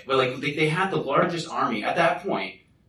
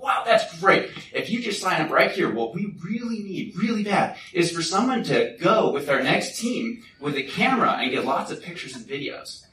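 lightly acted, high-pitched enthusiasm; eager recruiter; 7 semitones higher than usual. Recruiter asking a volunteer for camera help, eager. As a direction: pitch it brightly like a keen recruiter.

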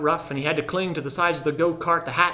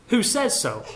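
higher, pushy challenge; demanding; 7 semitones higher, 3 dB louder than usual. A narrator's reading. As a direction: challenge him hard. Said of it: "I demanded".

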